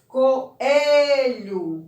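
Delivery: "Coelho" with the E stretched long and loud; stressed syllable drawn out. Shown as a demonstration. Teacher helping pupils find the stressed syllable, stretched and loud. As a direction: call out "Coelho", leaning hard on the long E.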